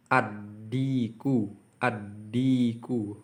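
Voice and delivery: "Ad-diku" said slowly, no l, doubled d; slow "ad-diku" with the l dropped into the d; assimilated l demo. Shown as a demonstration. Teacher showing students the silent l, slow and careful. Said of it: "maka tidak jelas".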